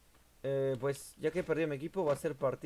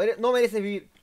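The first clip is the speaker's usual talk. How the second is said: loud, raised-pitch cursing; angry outburst; 8 semitones higher, 11 dB louder than usual. Real, not acted. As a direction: lash out loudly at an opponent. Cursing at game opponent.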